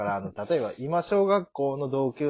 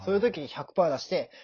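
ordinary talk

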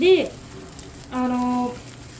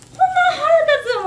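much higher and louder, venting; real annoyance; 13 semitones higher, 6 dB louder than usual. Real, not acted. Owner venting about a rude young customer, real exasperated outburst. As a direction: blurt out how angry it makes you.

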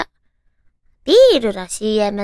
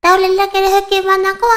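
fast ad line; 6 semitones higher, faster than usual. Retold or imitated. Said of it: "早口だね".

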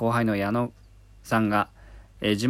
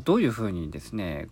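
ordinary talk, nothing marked

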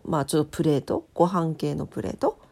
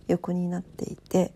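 ordinary delivery

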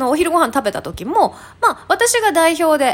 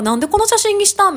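mock puzzled question; 7 semitones higher than usual. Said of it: "なんか".

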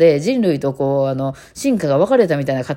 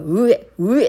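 higher, retch-like mock cat noise; mimicked noisy cat licking; imitated grooming noise; 6 semitones higher, 3 dB louder, slower than usual. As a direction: imitate a cat's over-bold grooming slurps. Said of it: "毛づくろいが".

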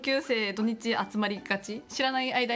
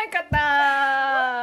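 excited cheer; 4 semitones higher, 8 dB louder, much slower than usual. Real, not acted. Wins the game, cheers.

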